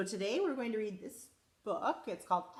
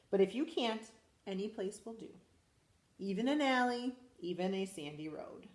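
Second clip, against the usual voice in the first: lively storybook read-aloud; animated picture-book reading; reading to children. Acted. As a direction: read it to a class of little kids. Teacher reads picture book aloud.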